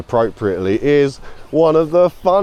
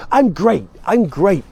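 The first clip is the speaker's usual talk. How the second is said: much higher, bubbly American cheer; upbeat American; 8 semitones higher, slower than usual. Retold or imitated. American guest answering a friendly question, bubbly and high-pitched. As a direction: gush like an overkeen American. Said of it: "a Bill Bailey sketch ... he's in America".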